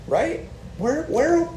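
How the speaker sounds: a disciple's high, bewildered plea; incredulous Peter. Retold or imitated. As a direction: ask it like there's nowhere else to go. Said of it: "He's like".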